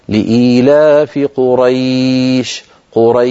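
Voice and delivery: لإيلاف قريش recited slowly, the ya of قريش drawn out; Quran recitation. Shown as a demonstration. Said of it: "نقول مثلًا". (تلاوة لإيلاف قريش بتأنٍّ)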